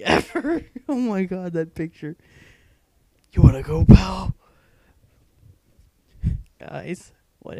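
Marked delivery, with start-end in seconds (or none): 3.3-4.4 loud, tough-guy "wanna go" taunt; mock challenge. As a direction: bark it like a bar brawler.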